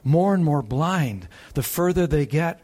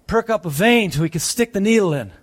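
usual speaking voice

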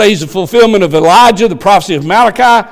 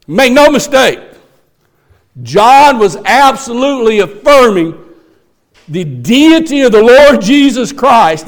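loud, high preaching at full force; fiery preaching; 5 semitones higher, 3 dB louder than usual. Acted. Preacher proclaiming John's claim to the church, loud and high. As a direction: drive it home to the congregation.

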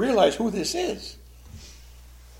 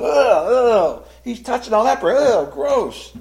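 higher, louder grossed-out ugh; disgusted crowd; 7 semitones higher, 7 dB louder, slower than usual. Acted. Crowd watching Jesus touch a leper, disgusted. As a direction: sneer in revulsion. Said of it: "all the crowd's going".

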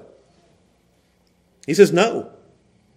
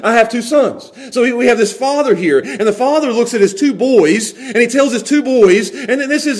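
ordinary talk

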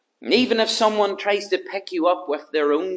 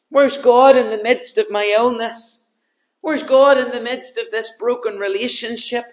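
louder and higher with each question; preacher voicing despair, rising to a cry; building anguished cry; 4 semitones higher, 6 dB louder than usual. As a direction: pile the questions up to a shout.

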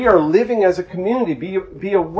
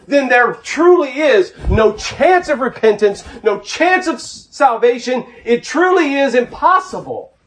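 fervent preaching; 8 semitones higher, slower than usual. Acted. Preacher pleading with the congregation, fervent and raised. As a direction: drive it home to the flock. Preacher builds to full force.

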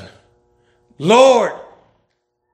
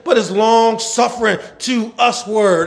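higher, shouted preaching; fervent shouted sermon; preaching at full force; 7 semitones higher, slower than usual. Acted. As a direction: shout the scripture at the crowd.

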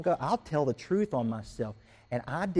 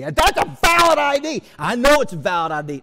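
loud, much higher mean insistence; trumped-up anger; 9 semitones higher, 9 dB louder than usual. Retold or imitated. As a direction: ham up a rude customer arguing back. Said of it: "I wasn't this mean".

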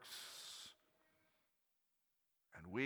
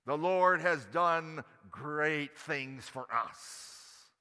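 ordinary talk

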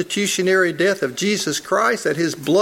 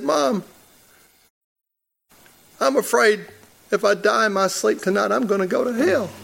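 higher, imitating a tearful scared boy; retold crying plea; 4 semitones higher than usual. A boy at bedtime tells his mom, higher mock tearful plea. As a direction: mildly mimic a frightened child crying to his mother. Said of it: "I was crying".

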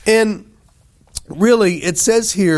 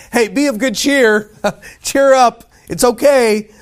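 higher, louder cheery call across water; 6 semitones higher, 5 dB louder than usual. Acted. Jesus calls out to the frightened disciples, calling out. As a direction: call out brightly to hearten them. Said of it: "he called out to them".

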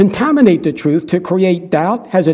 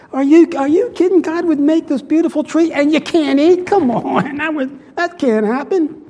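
higher, scoffing, half-laughing tempter; hammed-up incredulous tempter; devil's mock persuasion; 7 semitones higher than usual. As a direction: coax and scoff like a con man.

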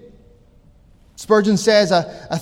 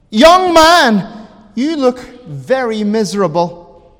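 calling out; 5 dB louder than usual. Retold or imitated. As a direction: call out to one man in the congregation. Preacher singles out Spurgeon.

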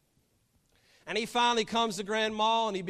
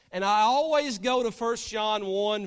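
normal talking voice

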